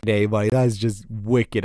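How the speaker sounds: ordinary talk, nothing marked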